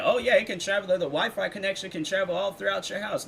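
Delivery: high, sarcastic mock of advertising; mocking sales pitch. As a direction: parody the box's bright promise. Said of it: "when they display it on the box".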